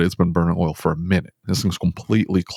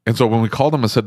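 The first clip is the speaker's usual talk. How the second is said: ordinary delivery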